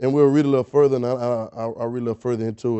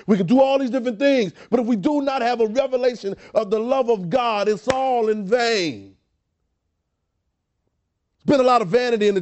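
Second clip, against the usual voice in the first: higher, driving sermon delivery; emphatic preaching; 6 semitones higher, 3 dB louder than usual. Real, not acted. Preacher urging the church toward revelation of God's love, emphatic preaching.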